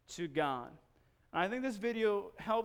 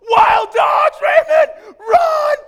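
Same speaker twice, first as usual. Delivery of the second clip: full-force, high-pitched warning shout; high, very loud scream of mock panic; yelled false alarm; 21 dB louder, much slower than usual. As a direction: yell a fake alarm to make someone bolt. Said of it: "And when I yell".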